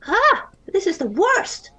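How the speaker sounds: put-on upset girl voice. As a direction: voice her near tears and frantic. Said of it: "her voice cracking".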